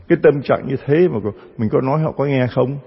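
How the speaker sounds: ordinary talk, nothing marked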